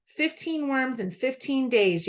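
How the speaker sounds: usual speaking voice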